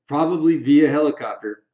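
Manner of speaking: usual speaking voice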